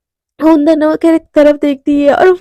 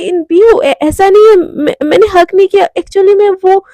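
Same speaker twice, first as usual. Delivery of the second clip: higher, louder, voice near tears; tearful pleading; 4 semitones higher, 5 dB louder than usual. Acted. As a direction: beg her to believe you, almost crying. Pleading girlfriend caught out. (रोती हुई, गिड़गिड़ाती आवाज़ में)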